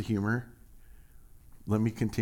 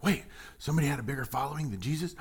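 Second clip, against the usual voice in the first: quick, breathless mock disbelief; 3 semitones higher, 4 dB quieter, faster than usual. Modern Christians hearing John outdrew Jesus, mock disbelief. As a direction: rattle it off in fake shock. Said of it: "it's like".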